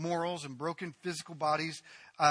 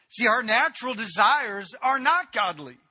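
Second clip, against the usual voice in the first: loud, rising sermon delivery, higher and louder; fiery pulpit crescendo; full-force preaching; 6 semitones higher, 8 dB louder than usual. Acted. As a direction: preach it hard to the congregation. Preacher's closing surge.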